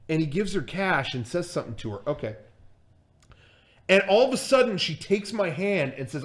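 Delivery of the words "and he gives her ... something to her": ordinary talk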